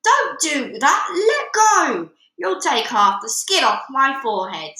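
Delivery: loud, high, desperate shouting; father shouting at his wife to let go; pained shout. Acted. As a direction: beg her to stop, yelling. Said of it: "he screamed".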